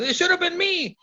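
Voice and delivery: high, whiny protest; high-pitched sulky complaint; whiny Jericho. Retold or imitated. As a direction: squeal it like a sore loser. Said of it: "He goes".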